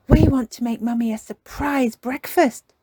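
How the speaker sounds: put-on bright, eager little girl voice; bursting to share a plan; excited. Little girl telling Daddy her plan, excited. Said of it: "said Peppa excitedly".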